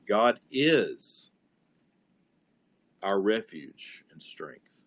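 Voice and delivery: God is our refuge and strength, slow and weighted; slow, deliberate meditation on "God is our refuge and strength"; word by word. Shown as a demonstration. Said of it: "chew on that for a minute, and then go to the next word".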